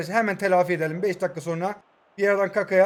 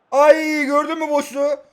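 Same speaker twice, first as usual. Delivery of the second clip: high, loud, stretched-out cry; excited exclamation; 7 semitones higher, 7 dB louder than usual. Real, not acted. Player points out the open space, worked up. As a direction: cry out on spotting the gap.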